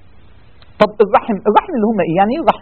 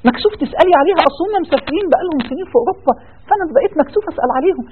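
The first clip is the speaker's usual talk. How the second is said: higher, lightly voiced excuse, a bit sheepish; embarrassed relative; 8 semitones higher than usual. Preacher voicing a relative too shy to call family, sheepish excuse. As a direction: play the shy cousin mumbling an excuse.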